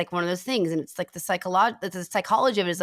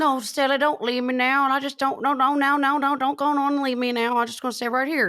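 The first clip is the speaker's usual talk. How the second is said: pleading voice a bit higher; Franklin's voice; 6 semitones higher than usual. Retold or imitated. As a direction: beg her not to leave, whining. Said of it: "No, I'm doing Franklin's voice".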